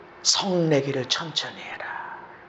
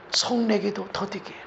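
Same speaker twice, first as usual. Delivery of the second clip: ordinary talk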